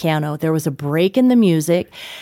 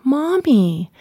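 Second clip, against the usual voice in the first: a lightly voiced small child, higher; five-year-old son; 7 semitones higher than usual. Son gently scolding his mother, lightly voiced higher child. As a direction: lift into a little kid scolding mommy. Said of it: "And Ethan, who's five, is like".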